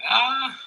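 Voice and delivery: high, loud "ah"; startled cry. Real, not acted. Reacting to the coil measurement, high loud cry.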